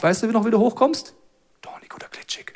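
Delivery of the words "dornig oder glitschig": whisper. Acted. Aside during Bible retelling.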